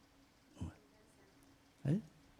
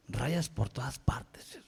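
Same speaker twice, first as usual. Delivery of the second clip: whisper; 2 semitones lower, 9 dB quieter, slower than usual. Real, not acted. Aside about abstract painting.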